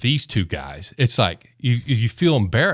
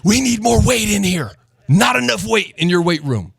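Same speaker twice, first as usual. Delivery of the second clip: much higher, louder cocky yell; defiant shout; 11 semitones higher, 4 dB louder than usual. Retold or imitated. Teammate mocking the coach after throwing dumbbells, loud and cocky.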